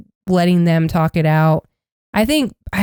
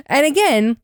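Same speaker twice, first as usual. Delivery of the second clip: ordinary delivery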